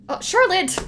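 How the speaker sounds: sudden high loud cry of fright; startled cry. Real, not acted. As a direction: jump out of your skin. Startled by ghost Charlotte.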